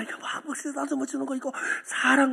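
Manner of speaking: half-whispered explaining; soft explaining. Retold or imitated. Son softly explains love to his mother. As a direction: hush it, tender and pleading.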